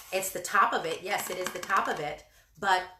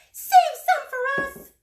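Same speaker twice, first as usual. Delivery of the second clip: high, loud little birds; begging small birds, much higher; small birds crying out; 8 dB louder than usual. Acted. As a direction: squeal it like tiny birds. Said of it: "cried the small birds".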